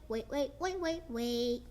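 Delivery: plain reading voice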